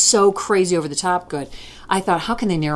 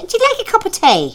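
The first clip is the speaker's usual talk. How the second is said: much higher, Scots granny voice; Scottish grandmother; 14 semitones higher than usual. Retold or imitated. Host imitates her Scottish grandmother offering tea, high granny accent. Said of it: "She'd say".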